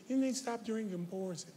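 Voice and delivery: soft, gentle celebrity imitation; mock-delicate, hushed warning. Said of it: "In my [name] voice".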